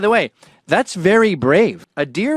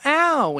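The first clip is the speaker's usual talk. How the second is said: high little "ow"; imitated hurt yelp; 11 semitones higher than usual. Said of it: "it's gonna go".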